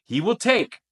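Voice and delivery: He will take high and loud, take punched; stress on take. Shown as a demonstration. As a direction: hit take hard. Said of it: "I tried to emphasize it for you".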